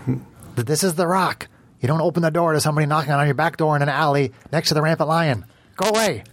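high scolding voice behind a door; muffled through a door. Acted.

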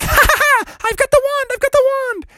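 very high squeaky cackle; a thief gloating at his escape; gloating frog. Acted. Froggy gloats.

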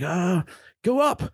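very high, hesitant voice of the clueless partner; clueless crewmate. Retold or imitated. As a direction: play him dumb and unsure. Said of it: "He's like".

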